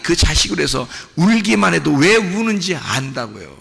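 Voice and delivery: ordinary talk, nothing marked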